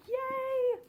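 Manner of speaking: a high, happy cheer of victory; excited yay. Real, not acted. She cheers after finishing the book, high and happy. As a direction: celebrate it, loud and high.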